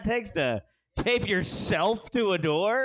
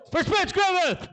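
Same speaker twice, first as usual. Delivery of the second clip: emcee call; 11 semitones higher than usual. Real, not acted. Host introducing next comic.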